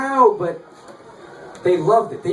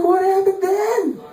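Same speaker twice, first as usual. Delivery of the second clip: very high, slow, strained question; acted-out exasperated prodding at its peak; escalated disbelief; 11 semitones higher, slower than usual. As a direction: draw it out high, desperate to know.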